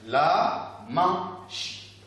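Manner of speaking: "La magie" very slow, in separate beats; long drawn-out syllables with pauses. Shown as a demonstration. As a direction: lead the class through la, ma, gie. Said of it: "Répétez ensemble".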